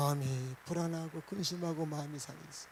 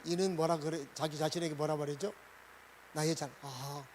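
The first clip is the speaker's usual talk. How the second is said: ordinary delivery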